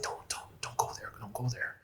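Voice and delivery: urgent whispered warning; fearful whisper. Retold or imitated. His body warning him, in a whisper. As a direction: whisper it like your body pleading. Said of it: "my cells and my body is like".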